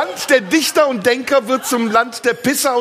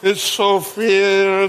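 put-on very slow Turkish-accented butler; much slower than usual. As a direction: drag it out, Dinner for One. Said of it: "Dinner for One auf Türkisch".

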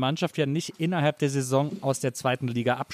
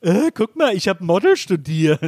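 Monchi; 5 semitones higher, 6 dB louder than usual. Retold or imitated. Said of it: "Monchi dann so sagt".

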